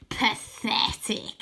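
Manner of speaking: slow, hissing sneer; Croaky sneering at Arthur, slow whisper; villain whisper. A narrator's reading. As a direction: savour the word. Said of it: "he says".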